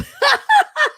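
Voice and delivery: very high, loud squeal of laughter; shrieking laugh. Real, not acted. Host finally catching the pubic typo, shrieking laugh.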